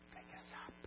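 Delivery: hushed whisper. Real, not acted. Preacher to congregation about helping each other, whispered. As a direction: breathe it to the congregation.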